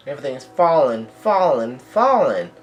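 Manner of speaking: stretched words with a swooping last fall; dramatic falling call. Acted. Reader dramatizing the castle collapse, mock-dramatic call. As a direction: let each falling drop away theatrically.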